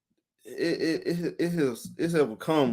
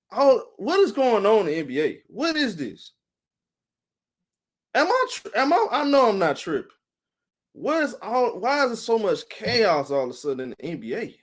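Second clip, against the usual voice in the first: higher, bewildered, asking what on earth is going on; playing his own shock at the flood of NBA news, pitched up; baffled disbelief; 6 semitones higher than usual. Streamer recalling reacting to chaotic NBA news, bewildered and pitched up. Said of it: "I'm like".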